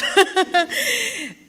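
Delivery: high burst of laughter; real, high-pitched short laugh. Real, not acted. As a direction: laugh at your own tease of the crowd. Laughs after teasing the audience.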